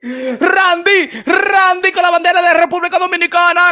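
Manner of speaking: hyping Randy in Spanish like a DJ; hype announcer. Acted. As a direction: shout him out like a club MC.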